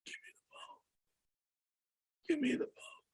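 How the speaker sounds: breathless whispered demand; dying father's whisper. Retold or imitated. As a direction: insist with no air left. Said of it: "my dad sounded more like this".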